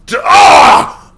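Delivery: sudden high loud yelp of pain; pained cry. A narrator's reading. Hero cries out as he is attacked, pained yell. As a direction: cry out as the blow lands.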